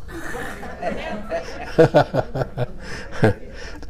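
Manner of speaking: quiet, airy chuckle at his own joke; soft breathy laugh. Real, not acted. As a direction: let a soft, mostly voiceless laugh slip out.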